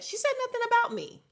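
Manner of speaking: squeaky high mock voice, fast; sarcastic high-voiced imitation of a castmate; mocking high-pitched Kyle. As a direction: mimic her in a silly high pitch. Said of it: "when you said".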